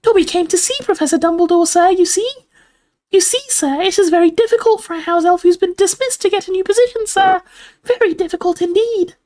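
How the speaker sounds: very high, squeaky elf voice; eager house elf. A narrator's reading. Dobby explains his job hunt to Harry, squeaky elf.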